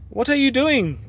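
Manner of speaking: high yell over the noise. A narrator's reading. Angry Pepper yelling at Tom over the hammering. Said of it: "Pete yelled above the noise".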